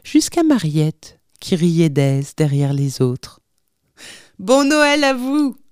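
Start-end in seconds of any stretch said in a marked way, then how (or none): 4.4-5.6 high, drawn greeting call; joyful cry. A narrator's reading. Father greeting his family from the doorway, cried out. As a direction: call it out merrily on arriving home. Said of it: "cria-t-il du seuil".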